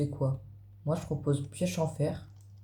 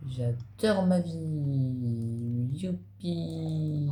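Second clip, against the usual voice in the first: very slow, stretched-out cheer; mock joy; much slower than usual. Player cheering his own progress to viewers, mock joy. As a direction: draw every vowel out in a playful yippee. Mock celebration while crafting.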